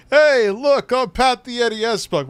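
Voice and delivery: high, loud, goofy voice mocking the streamer; mocking Pat impression. Friend mocks the streamer's stream intro in a high, loud goofy voice. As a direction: squeal it out like a dopey show-off.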